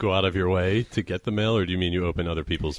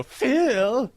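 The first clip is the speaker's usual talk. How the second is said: much higher rising call of Phil; mimicking how Phil says it. Teasing her friend by copying his call, high rising. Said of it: "the way you go".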